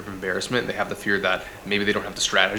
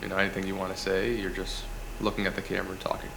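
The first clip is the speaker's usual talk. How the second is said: softer, dull monotone drone; 9 dB quieter, faster than usual. Shown as a demonstration. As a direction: talk like a bored presenter with no take. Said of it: "in a very monotone voice like this".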